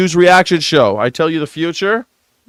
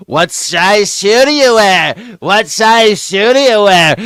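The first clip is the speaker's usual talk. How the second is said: mock Trump; 5 semitones higher, slower than usual. Imitating Trump asking shoe sizes.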